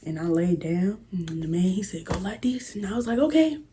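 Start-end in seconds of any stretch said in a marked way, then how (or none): 2.1-2.8 hushed whisper; whispered instruction. Retold or imitated. Attendant whispering to the child how to lie down. 3.2-3.6 squeaky high okay; high little okay. Retold or imitated. Child agreeing to the attendant, squeaky high.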